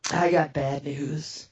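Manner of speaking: low, slow delivery; acted-out solemn announcement; grave bad news. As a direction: break it slowly and low. Breaks bad news to her.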